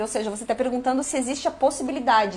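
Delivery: ordinary talk, nothing marked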